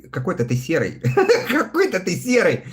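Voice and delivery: usual speaking voice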